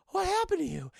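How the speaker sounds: high, loud aghast question; mock shock. Burglar seeing his battered partner, mock shock. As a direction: act out a horrified double take.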